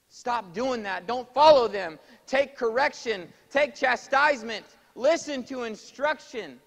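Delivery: high, loud commands from the pulpit; forceful exhortation. Real, not acted. Preacher ordering listeners to take correction, loud urgent exhortation. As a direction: hammer out each command at full volume.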